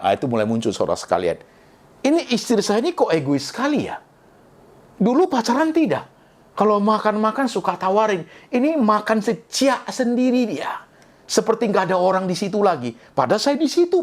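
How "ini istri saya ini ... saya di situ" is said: lightly voiced aggrieved husband, pitched higher; a husband whining to his pastor about his selfish wife; complaining husband. A husband complaining to the pastor, mildly aggrieved higher voice.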